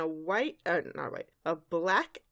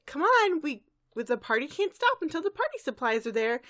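peppy Pinkie Pie; 8 semitones higher than usual. Retold or imitated. Pinkie Pie urging Fluttershy along, peppy. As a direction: bounce it out eagerly. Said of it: "And she's like".

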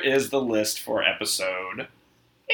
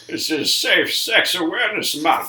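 masked villain voice, laughing; 8 semitones higher, 4 dB louder than usual. Pretend. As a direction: crack up mid-character. Bane shopkeeper bit, laughing.

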